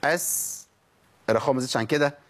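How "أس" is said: أس ending on a measured soft hiss; drill of still seen. Shown as a demonstration. Teacher for students to repeat, أس with measured hiss. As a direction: let the s of أس flow briefly, then stop. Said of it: "نقولها وتقولوها ورايا".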